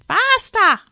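piercing, very loud high squawk; parrot scream. A narrator's reading. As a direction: screech it at full force. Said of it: "it screamed in his ear".

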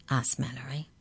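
ordinary delivery, nothing marked

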